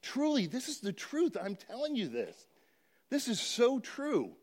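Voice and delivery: mild emphatic voicing of Jesus reassuring the disciples; insistent Jesus. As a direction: press the truth home gently. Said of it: "put that word in there and say".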